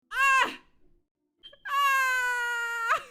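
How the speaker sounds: short high scream, then a long one; repeated screams. Real, not acted. Streamer losing nerve before the challenge, screaming. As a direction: yell out in dread.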